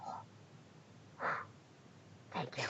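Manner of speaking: breathy hushed thanks; whisper. Acted. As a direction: whisper it gratefully.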